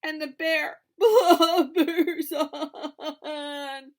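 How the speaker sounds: slow, loud, laughing through blubbers; laughing read. A narrator's reading. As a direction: giggle through the crying line. Narrating crying with laughs.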